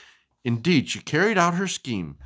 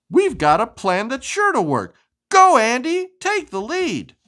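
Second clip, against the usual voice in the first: cheering witches; 9 semitones higher, 4 dB louder than usual. Acted.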